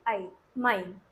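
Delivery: "माइन" with an आई sound; model pronunciation. Shown as a demonstration. Teacher shows the ईआई sound to students, model pronunciation. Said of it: "इसको क्या पढ़ेंगे?".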